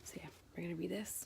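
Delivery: hushed whisper, trying not to wake him. Real, not acted. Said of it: "whispering because Jose is trying to put Lucas to sleep".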